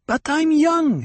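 high, loud woman's wail; petulant high-pitched outburst; indignant repeated protest. A narrator's reading. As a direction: cry it out high in outrage. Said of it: "Hera repeated".

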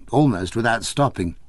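usual speaking voice

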